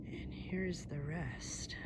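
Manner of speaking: slow hushed whisper. Real, not acted. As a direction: whisper it at the view. Quiet at the summit view.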